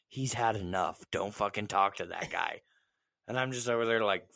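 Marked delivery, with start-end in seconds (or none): 0.1-2.6 imitating wary whisperers. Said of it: "They're like".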